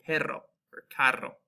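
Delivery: "perro or carro" with a trilled Spanish RR; rolled R. Shown as a demonstration. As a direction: roll the R in "carro" like a native. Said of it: "pronounce it like a true Espanol".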